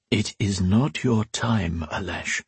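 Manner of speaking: slow, soft and sorrowful; the old priest consoling a dying Alesh; low, sad. Acted. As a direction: gently break the news with sadness. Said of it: "in a low, sad voice".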